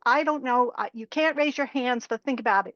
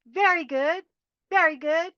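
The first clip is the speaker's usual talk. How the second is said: very good higher with a rising end; enthusiastic praise; 5 semitones higher than usual. Acted. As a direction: cheer the group on. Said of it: "congratulate yourself".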